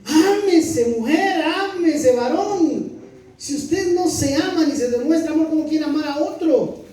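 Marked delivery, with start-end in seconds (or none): 0.0-3.0 fervent plea. Real, not acted. Preacher urging men and women to love themselves, fervent. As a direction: urge them with rising fervor.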